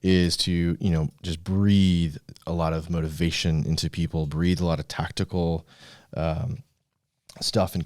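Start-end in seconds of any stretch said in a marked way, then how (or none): none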